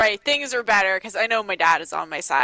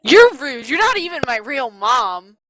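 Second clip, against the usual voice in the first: louder, higher bratty shout; bratty outburst; 4 semitones higher, 4 dB louder than usual. Acted. The teen shouts back at her would-be stepmom, bratty and loud. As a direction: throw it back at her like a furious teenager.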